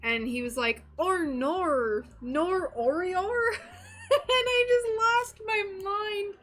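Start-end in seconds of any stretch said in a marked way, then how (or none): 1.0-3.3 hammed-up Aussie "or nor", high and drawn out; slow, loud mock Australian whine; Australian accent. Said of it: "doing impressions of if he was in Australia". 3.3-6.3 high, loud, words breaking into laughter; cracking up while talking; helpless laughing squeal. Real, not acted. Laughing at her retold impression.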